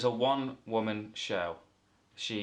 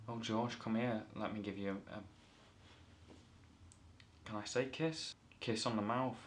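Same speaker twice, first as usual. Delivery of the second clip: mock tender; 8 dB quieter than usual. [name] moves in for kiss.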